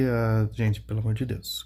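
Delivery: ordinary talk, nothing marked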